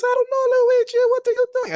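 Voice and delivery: extremely high, loud cartoon Mario. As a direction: squeal it out like a goofy toy Mario. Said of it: "Mario and Luigi".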